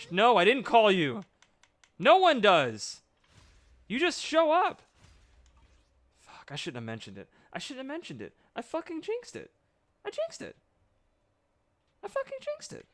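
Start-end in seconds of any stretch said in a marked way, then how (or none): 0.1-4.8 loud, high scolding; annoyed at the character. Acted. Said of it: "talks to the character". 7.5-12.9 high, whining self-blame; whiny complaint. Real, not acted.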